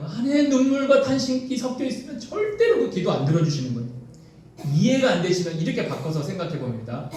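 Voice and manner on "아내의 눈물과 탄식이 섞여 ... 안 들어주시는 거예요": high-pitched, emphatic warning; impassioned preaching. Real, not acted. Preacher warning husbands about their wives' tears, impassioned preaching. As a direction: press the warning on the congregation.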